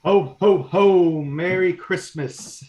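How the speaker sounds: slow, loud jolly Santa; hearty Santa greeting. Pretend. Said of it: "Mister Kirkpatrick".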